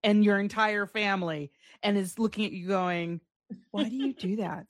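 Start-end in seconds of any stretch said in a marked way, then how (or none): none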